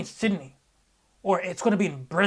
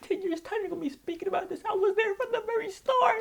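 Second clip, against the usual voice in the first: overcome with hype. Retold or imitated. Boasting to onlookers about brother's greatness, high overwhelmed squeal. Hyped retelling of boast on stage.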